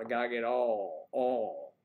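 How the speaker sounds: Virginia drawl on all, said for oil; mock Southern Richmond accent; imitating Richmond accent. Richmond friends talking, Southern accent. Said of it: "they were talking about".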